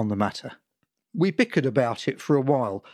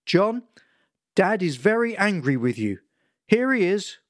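high, raised anxious voice; worried mother; 6 semitones higher than usual. Acted. Mother telling son Dad is angry, anxious. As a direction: hand the phone over, worried and stern. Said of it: "mother passing phone to Dad".

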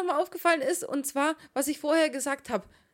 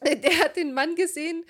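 normal talking voice

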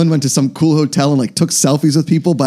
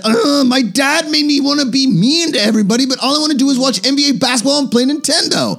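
put-on high-pitched sulky child voice; much higher, put-on little-boy voice; whiny kid; 10 semitones higher than usual. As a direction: play a kid complaining about Dad. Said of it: "he's the kid that said".

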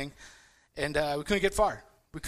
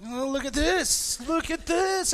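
high, slow, excited "look at this"; excited discovery; 10 semitones higher, slower than usual. Retold or imitated. Boy showing siblings the manual, excited. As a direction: call the siblings over to see. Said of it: "I'm like".

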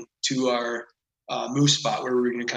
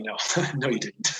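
quoted reply broken by a laugh; laughing denial. Retold or imitated. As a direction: chuckle through the guides' answer. Laughs while quoting the guides' denial.

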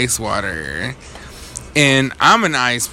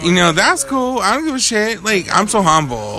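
pitched way up, emphatic; emphatic insistence; 8 semitones higher than usual. Real, not acted. Insisting he's humble.

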